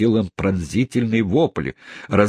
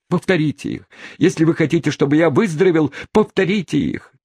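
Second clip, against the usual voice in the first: pleading; 10 semitones higher, faster than usual. Acted. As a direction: press her to say them again. Tupman pleading for the words. (настойчивая мольба)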